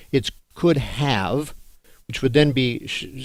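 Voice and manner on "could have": "could have", slow with a full HAVE; correct full form. Shown as a demonstration. Teacher correcting students' error, slow deliberate "could have". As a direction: draw out "could have" as two whole words. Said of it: "That's not even English".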